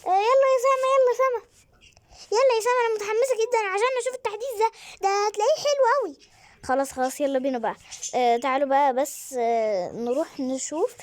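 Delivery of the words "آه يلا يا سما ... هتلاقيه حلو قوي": put-on very high, loud child calling her sister; fast high-pitched kid voice; excited kid voice. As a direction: squeal it like an eager little girl. Voicing second kid calling Sama.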